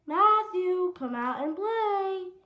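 high, loud singsong call; hollering. Acted. Froggy calling at Max's house, high holler. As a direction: holler for a friend to come out.

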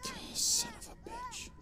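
very high shrieking curse, crying out; terrified shriek. Real, not acted. Streamer shrieking at a horror game monster, terrified shriek. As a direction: scream it in pure terror.

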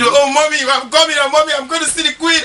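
high, excited, a bit fast; thrilled fan about to meet the queen; mock excitement. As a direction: squeal about seeing the queen.

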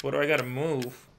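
ordinary talk, nothing marked

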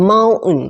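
"mountain" said as "mow-un", T dropped; American "mountain" with no T sound; silent T. Shown as a demonstration. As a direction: drop the T in "mountain", American style. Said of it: "เขาจะออกเป็น mountain".

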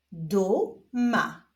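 stretched first syllable, pause, then second; drawn-out, divided syllables; syllable reading. Shown as a demonstration. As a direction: read it slowly as two separate syllables. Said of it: "zapraszam Was do odczytywania sylab".

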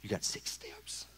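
slow unvoiced whisper. God's voice guiding Drew to the water, whisper. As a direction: whisper it slowly.

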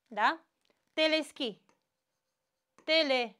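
ordinary talk

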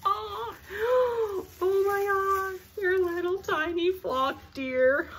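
high, slow, emotional squeal; overjoyed squeal. Real, not acted. Collector finding tiny flocked deer, overjoyed squeal. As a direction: squeal with delight, drawing the words out.

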